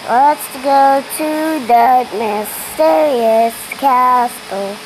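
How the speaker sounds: slow, loud and grand; dramatic announcer. Acted. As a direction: announce it like an epic trailer.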